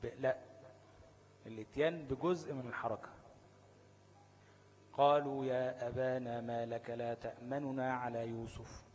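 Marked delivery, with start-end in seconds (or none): 4.9-8.8 slow verse, تأمننا with a quick partial damma on the nun; recited with rawm. Shown as a demonstration. Teacher demonstrates rawm in the verse, slow and careful. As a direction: give a brief fraction of the damma on the nun in تأمننا. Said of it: "هتجيب الضمة بتاعت النون بس بسرعة".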